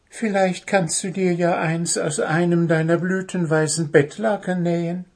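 high, gentle bird voice; high little bird, soothing; consoling bird. Acted. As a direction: try to comfort the gnome. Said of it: "um Puh zu trösten".

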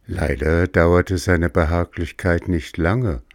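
ordinary delivery, nothing marked

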